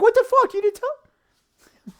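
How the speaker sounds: very high, slow disbelief; incredulous outrage. Real, not acted. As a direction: squeak out the betrayal. Outrage at hidden news.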